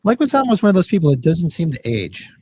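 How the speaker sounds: ordinary delivery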